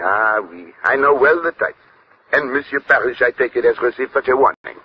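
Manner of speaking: French-tinged English of a Belgian detective; courteous Belgian French accent. Acted.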